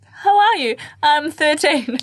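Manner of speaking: very high, eager learner voice breaking into laughter; high-pitched mock small talk; botched greeting re-enacted. Acts out botched first English greeting.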